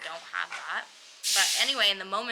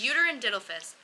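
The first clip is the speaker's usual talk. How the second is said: ordinary talk, nothing marked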